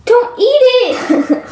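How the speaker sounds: high, slow "don't eat it"; high drawn-out warning. Real, not acted. Warning friend about eating slime.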